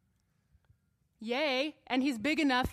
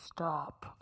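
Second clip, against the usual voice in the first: put-on manly voice, much lower; low, softer, hammed-up masculine command; 8 semitones lower, 5 dB quieter than usual. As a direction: drop way down for a mock man's "stop". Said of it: "That was my best manly voice".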